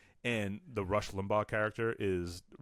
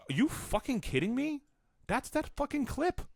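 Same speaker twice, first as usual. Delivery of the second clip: high-pitched exasperated disbelief; incredulous outrage. Real, not acted. Host outraged at a biased debate moderator, incredulous. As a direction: let the voice leap way up in disbelief.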